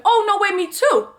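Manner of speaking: high-pitched imitation of delighted surprise; mock surprise. Imitating the stalker claiming the same area, mock surprise.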